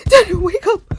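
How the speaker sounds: high, loud yell to wake someone; panicked shout. Retold or imitated. Girl waking her sleeping cousin, panicked shout. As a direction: shout them awake, high and urgent.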